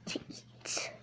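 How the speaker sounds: hushed spooky whisper. Acted. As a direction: whisper it like a secret. Spooky whisper about wild cat.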